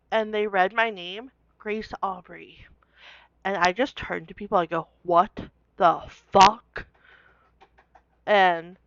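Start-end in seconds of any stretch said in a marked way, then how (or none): 5.0-6.9 drawn out, very slow what the fuck; stunned disbelief. Retold or imitated. To classmates after hearing her name, a slow stunned disbelief. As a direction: let it crawl out, slow and floored.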